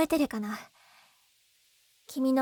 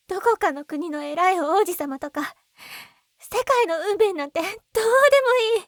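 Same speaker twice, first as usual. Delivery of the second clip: defiant outburst; 3 semitones higher, 5 dB louder than usual. Acted. Rejecting prince and fate.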